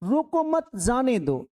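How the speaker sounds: group "रोको मत" as one phrase, then drop on "जाने दो"; pause after मत. Shown as a demonstration. Teacher reads a public sign to students, pausing after "मत". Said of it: "कि रोकना नहीं है, जाने देना उसको".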